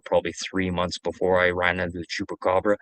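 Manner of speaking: ordinary talk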